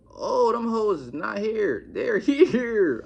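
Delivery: hammed-up, high and loud dismay; mock dismay. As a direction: stretch it out like an incredulous retelling. Acted dismay at seeing the girls.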